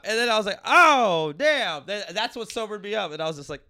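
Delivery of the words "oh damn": high, booming retold jolt; loud shock. Guest acting out the moment that sobered him up, loud shock. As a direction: shout it as a mock sudden wake-up.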